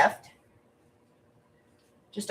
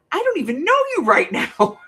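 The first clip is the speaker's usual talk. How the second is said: stunned husband; 9 semitones higher, 6 dB louder than usual. Retold or imitated. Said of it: "And he's like".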